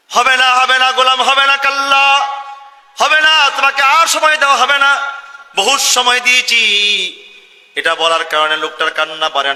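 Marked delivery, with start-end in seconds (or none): 0.1-6.6 high, loud final no; thundering refusal. Acted. God refusing the sinner more time, loud and high. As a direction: refuse with booming authority. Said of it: "আল্লাহ সঙ্গে সঙ্গে বলে".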